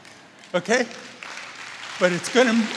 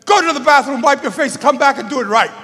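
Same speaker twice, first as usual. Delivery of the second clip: loud, higher, commanding director; imitating a stern order; 6 semitones higher, 8 dB louder than usual. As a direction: bark the instruction at the crying actress. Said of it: "and he says".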